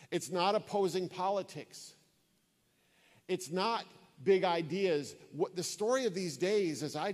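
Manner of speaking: rising, emphatic sermon build; preaching. Acted. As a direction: drive each "not" home from the pulpit.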